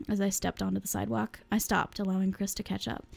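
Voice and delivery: ordinary delivery, nothing marked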